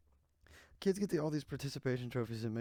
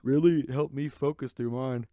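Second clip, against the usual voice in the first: sarcastic; 11 dB louder than usual. Real, not acted. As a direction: scoff at the claim. Sarcastic retort to the ad.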